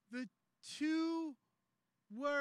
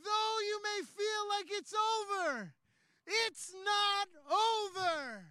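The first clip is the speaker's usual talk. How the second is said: impassioned preaching; 6 semitones higher, 7 dB louder than usual. Acted. Preacher proclaiming resurrection to the congregation, impassioned and building to a shout. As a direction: preach it at full force, slowing on the big lines.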